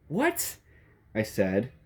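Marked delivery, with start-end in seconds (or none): 0.1-0.6 very high baffled squeak; startled what. A narrator's reading. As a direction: squeak it in total confusion. Narrator startled on the phone.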